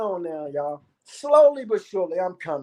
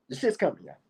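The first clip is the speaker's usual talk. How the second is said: ordinary delivery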